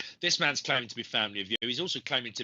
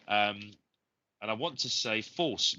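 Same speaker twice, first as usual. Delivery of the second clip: normal talking voice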